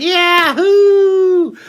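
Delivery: high, loud, thrilled scream; screamed yahoo. Retold or imitated. Grabbing the dolphin's fin, loud yahoo scream. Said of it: "I screamed and I went".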